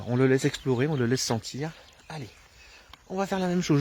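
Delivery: ordinary delivery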